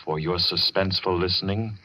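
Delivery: ordinary delivery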